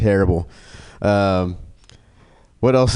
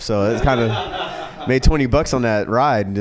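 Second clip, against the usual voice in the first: normal talking voice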